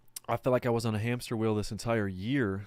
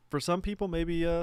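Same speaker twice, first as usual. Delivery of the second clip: high-pitched; 8 semitones higher, 3 dB louder than usual. Real, not acted. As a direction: voice jumps up high.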